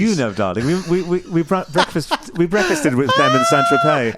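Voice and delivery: lightly posh high-society voice; a hint of a snooty aristocrat; posh socialite voice. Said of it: "You've got such a voice on you".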